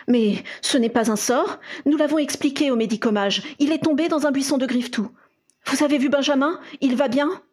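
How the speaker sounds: protest, then ask with restrained concern; contained worry. A narrator's reading. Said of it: "l'inquiétude contenue dans la voix de l'homme".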